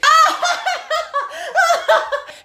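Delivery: high, mock hysterical cackle; long high-pitched hammed-up laugh; hysterical laughter. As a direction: imitate a driver in fits of laughter. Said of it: "The bus driver starts laughing hysterically".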